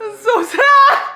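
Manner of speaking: high, loud, drawn-out wail of sorrow; distraught outcry. Real, not acted. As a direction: wail it out at full volume. Upset reaction to the book.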